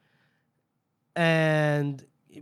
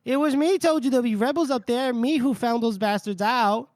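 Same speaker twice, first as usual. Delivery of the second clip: higher, louder braggart; boasting mercenary; 7 semitones higher, 3 dB louder than usual. Acted. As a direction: brag like a greedy sellsword claiming credit. Narrator voices Cantless boasting.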